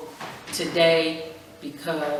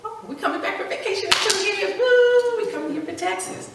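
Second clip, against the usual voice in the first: mock excited. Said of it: "And I was like".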